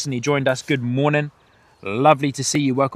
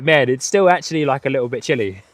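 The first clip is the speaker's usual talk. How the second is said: normal talking voice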